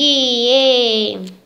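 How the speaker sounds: cheer. Real, not acted. Celebrating success.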